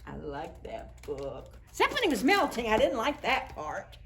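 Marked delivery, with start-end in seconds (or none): none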